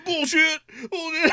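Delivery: high, repeated mock protest; imitated indignant catchphrase. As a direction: mimic his stock offended answer, amused. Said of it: "that was his answer to everything".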